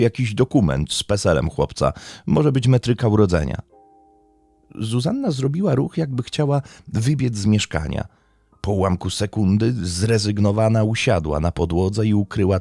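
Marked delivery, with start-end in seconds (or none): none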